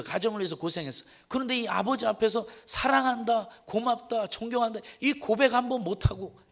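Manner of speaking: fervent sermon, voice lifted high; impassioned preaching. Acted. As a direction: press the congregation with feeling.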